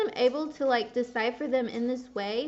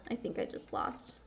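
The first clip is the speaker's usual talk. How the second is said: deflated; 10 dB quieter than usual. Real, not acted. Deflated after losing the game.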